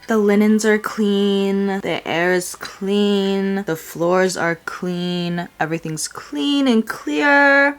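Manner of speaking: slow, savoring each clause; slow and deliberate. Real, not acted. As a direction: linger over each line. Slow listing cleanliness.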